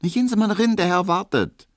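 very high, brisk maid's voice; impatient shooing. A narrator's reading. Maid sends the barber in to her waiting master, impatiently. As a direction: send him inside in a high voice.

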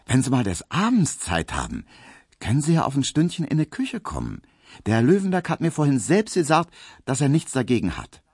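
a put-on girlish maid; coy maid Pauline. A narrator's reading. Pauline voiced by narrator.

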